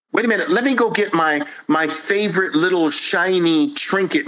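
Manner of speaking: high, pleading whine; mock protest, high and nasal; whiny excuse. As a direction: whine like a stalling child. Whiny objector.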